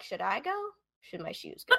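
half-whispered pretend dithering; mock pondering. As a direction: play a hushed, silly moment of indecision. Imagining the killer debating his shoes.

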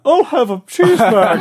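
high, loud silly ordering voice; hammed-up drive-through customer; put-on customer voice. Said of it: "like four people or something".

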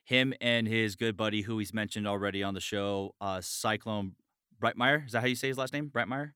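ordinary delivery

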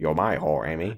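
low, soft British impression; deep British film-star imitation; Michael Caine. Shown as a demonstration. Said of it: "kinda is Michael Caine".

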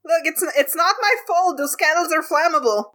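high-pitched, flustered protest; defensive excuse. Acted. Mox to Dawn about the fire, defensive. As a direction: blurt out an excuse.

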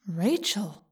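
soft, surprised inner voice; surprised whispery voice. A narrator's reading. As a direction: wonder it to yourself. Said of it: "The voice was surprised".

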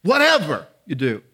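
"Whatever" punched hard, then a drop on "you do"; emphatic stress. Real, not acted. Preacher emphasizing the key word of the verse, emphatic. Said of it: "I really wanna emphasize that".

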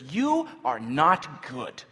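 loud, slow accusation; acted yelling. Traveler turning on servant girl, acted yelling. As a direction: spell out the condemnation slowly, with force. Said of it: "begins to yell at her".

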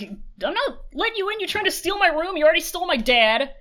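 high, petulant kid voice, hint of mock sulk; bratty child Jiang Cheng. Kid brother refusing to share his room, high bratty voice. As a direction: play the jealous little brother guarding his room. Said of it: "And he's like".

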